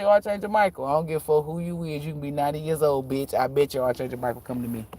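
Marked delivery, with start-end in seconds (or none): none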